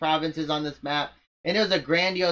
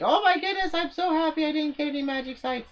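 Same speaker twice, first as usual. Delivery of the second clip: much higher, gushing fake joy; sarcastic high-pitched gloat; mock delighted gloating; 13 semitones higher than usual. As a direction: squeal it like a smug rival pretending to be thrilled. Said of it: "he'll be like".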